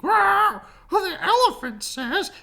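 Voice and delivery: high, loud frantic bird cries; crying birds. A narrator's reading. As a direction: squawk out a loud warning. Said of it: "cried the birds in the trees".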